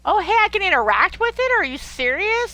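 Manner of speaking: light up at the surprise; excited surprise. Real, not acted. Discovers a new game interaction.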